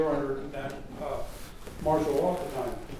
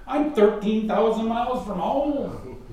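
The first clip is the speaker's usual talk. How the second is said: higher-pitched mock complaint; retold plaintive protest; 8 semitones higher, 4 dB louder than usual. Lying in bed questioning God, plaintive protest. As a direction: protest to the Lord, pitch climbing.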